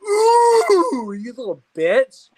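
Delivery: high, loud mock rage at a rival; angry cousin impression, shouted and high; imitating angry cousin. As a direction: squeal then snarl an insult, hammed up. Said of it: "and I'm like".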